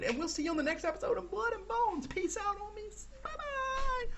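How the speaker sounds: high, rising cheery outro; bubbly signoff. Real, not acted. Gamer signs off cheerfully to viewers, high. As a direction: climb up in pitch to the goodbye.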